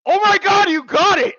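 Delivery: high, loud shout of disbelief, breaking into laughter; astonished outburst. Real, not acted. Player stunned that the co-host got it, astonished shout. AI gets guess right, amazed reaction.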